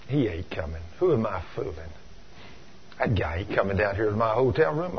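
low, soft, deflated self-talk; dejected resignation. Retold or imitated. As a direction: give up on someone who won't show. Giving up on waiting.

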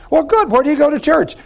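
high-pitched, over-friendly hail; hammed-up cheery small talk; hearty church greeter. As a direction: act out a chirpy brother's greeting. Greeter follows up, acted.

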